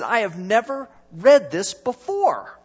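put-on slow, loud Irish accent; friend's Irish accent, slowed down. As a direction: lean into a thick Irish accent, deliberately. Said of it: "He said".

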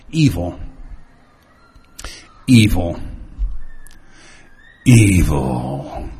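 Evil said low, slow and menacing, stretched out; sinister evil. Shown as a demonstration. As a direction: make Evil sound evil, dark and drawn out. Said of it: "so that it sounds like what it is".

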